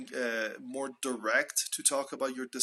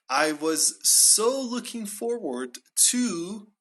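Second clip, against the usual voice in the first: high, loud, slow stress on "so looking forward"; emphatic expectation; 7 semitones higher, 5 dB louder, slower than usual. Shown as a demonstration. Teacher emphasizes expectations before the movie, "so looking forward" slow and raised. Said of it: "for emphasizing the expectations that you had".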